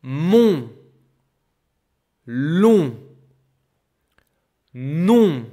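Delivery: Mon, Long, Non with a loud nasal on; teacher drilling nasal on in words; nasal vowel words. Shown as a demonstration. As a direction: sing out each word through the nose. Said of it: "I am using my nose".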